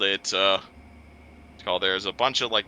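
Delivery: ordinary delivery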